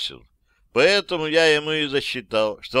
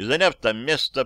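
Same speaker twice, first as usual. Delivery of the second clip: plain reading voice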